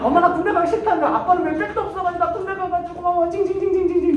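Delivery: high, put-on whiny voice; hammed-up childish whining; whining to mom. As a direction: complain like a spoiled son on the phone. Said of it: "이래요".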